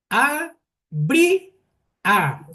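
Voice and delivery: teacher marking "bri" as the stressed syllable; stressed syllable demo. Shown as a demonstration. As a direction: hit the syllable "bri" hard. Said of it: "la sílaba tónica es".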